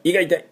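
"胃が痛い" high and squeezed on the i; clenched, high "胃が痛い"; strained stomach-ache i. Shown as a demonstration. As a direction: strain "胃が痛い" as if the belly hurts. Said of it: "こんな感じ。".

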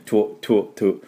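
"トゥ" as short tw bursts; quick isolated "トゥ" drills; double consonant. Shown as a demonstration. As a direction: puff out each "トゥ" with t and w fused. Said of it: "ダブルシーン。".

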